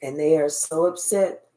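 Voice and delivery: low, hushed reading of the spirit's message; channelled message. Acted. Reader relaying the spirit's message to the listener, low and hushed. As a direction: ask the spirit quietly, then relay it.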